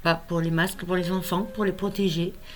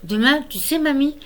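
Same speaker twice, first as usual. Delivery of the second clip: higher-pitched imitation of a small grandchild; lightly voiced child, lifted and hesitant; little child's voice; 4 semitones higher, 4 dB louder than usual. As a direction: quote the little one in a raised voice. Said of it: "de ta petite voix".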